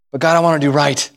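low, humble appeal to God; contrite plea. Acted. Believer choosing to confess to God, contrite plea. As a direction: submit sincerely, no argument left. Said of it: "Or will I say".

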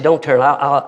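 usual speaking voice

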